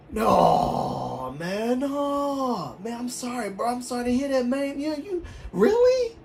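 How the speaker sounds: high, sympathetic Southern guy imitation; high-pitched mock Southern commiseration; Southern US accent. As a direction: wail the condolences in a put-on Southern voice. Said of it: "Everybody talks like this".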